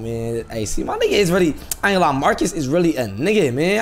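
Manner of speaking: normal talking voice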